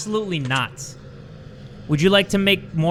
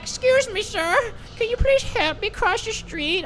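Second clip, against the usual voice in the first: high, lightly voiced little old lady asking. Imagined old lady politely asking him for help, high and frail. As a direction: put on a frail, polite granny voice, pitched high. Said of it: "if she was like".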